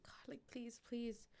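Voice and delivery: soft breathy whisper to God; whispered prayer. Retold or imitated. Mother praying while they press on her, whispered. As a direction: pray under your breath. Mother prays during compressions.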